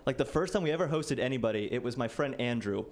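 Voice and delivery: ordinary talk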